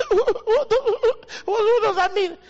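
high, loud crying question; incredulous outburst. Real, not acted. Pastor scoffing at a weak excuse, high incredulous cry. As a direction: demand an answer in mock outrage.

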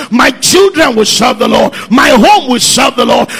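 loud, high defiant declarations; full-force preaching. Acted. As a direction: shout it to the devil from the pulpit.

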